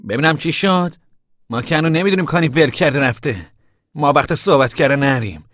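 high, loud, urgent voice; impatient interruption. Acted. Tom breaks up the family's talk, loudly impatient. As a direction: cut into the argument forcefully.

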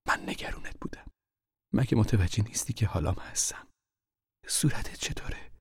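urgent whisper in the dark; hushed whisper. Acted. Mother whispering warnings to her hiding son. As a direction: keep it breathy and secret.

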